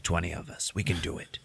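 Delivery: mock hushed reassurance; hushed whisper. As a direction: whisper it like a secret pledge. Cassian quietly reassuring Jyn.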